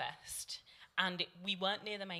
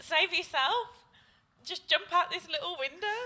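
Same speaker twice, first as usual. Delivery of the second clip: high, loud sarcastic taunt; mock jeer; 10 semitones higher, 7 dB louder than usual. Friends taunting Billy escaping the minibus, loud sarcastic jeer. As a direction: egg him on with fake cheer.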